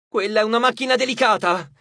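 high-pitched alarmed protest; shouted warning. A narrator's reading. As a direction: cry out to protect a fragile machine. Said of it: "gridò Leo".

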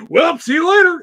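high, loud sing-song goodbye; hammed-up breezy see-you-later; mock cheery shout. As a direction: toss off a bright shouted farewell. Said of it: "he's like".

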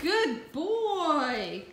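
high loud praise for the dog; squeaky praising a dog. Real, not acted.

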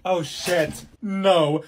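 usual speaking voice

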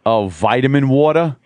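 "vitamin water" with a flapped d, vaɪdəmɪn wɔtə˞; mock American accent, lightly done; cheesy American accent. Shown as a demonstration. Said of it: "make it sound really cheesy, dirty American".

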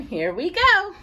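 usual speaking voice

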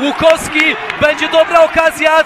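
rising excited play-by-play; excited shout. Real, not acted. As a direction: call the chance loud and high. Live goal chance commentary.

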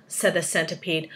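ordinary delivery

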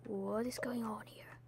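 hushed whispered question; a soft, confused whisper. Real, not acted. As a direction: whisper it, bewildered. Whispered repeat.

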